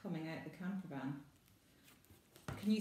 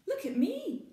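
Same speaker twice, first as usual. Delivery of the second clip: very high, loud call; excited cry; 8 dB louder than usual. Acted. Maggie splashing in the fairy pools, gleeful cry. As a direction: shout with glee. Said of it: "Maggie cries".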